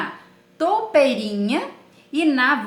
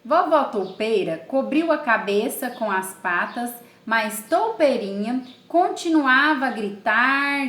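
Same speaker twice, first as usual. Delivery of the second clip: normal talking voice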